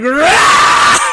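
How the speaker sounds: loud high rah of pain; pained roar. Retold or imitated. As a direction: bark out rah as if stabbed. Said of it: "it's like".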